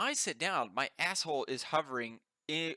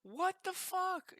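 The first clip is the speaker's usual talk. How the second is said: stunned disbelief; much slower than usual. Real, not acted. Disbelief at the story.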